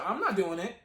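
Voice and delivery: normal talking voice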